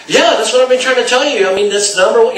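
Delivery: mock pushy salesman jumping in; overeager. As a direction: blurt it out too eager. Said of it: "If I'd have said".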